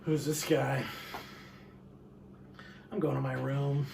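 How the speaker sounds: low, lightly voiced standoffish cat; low, understated cat grumble; aloof cat. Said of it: "the cat just lurks around the background".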